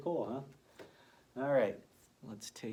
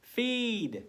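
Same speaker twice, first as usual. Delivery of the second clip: feed leaps high and loud; teacher revealing feed to a young reader; excited reveal; 12 semitones higher, 8 dB louder than usual. Shown as a demonstration. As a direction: sing out feed with big delight. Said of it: "And we have".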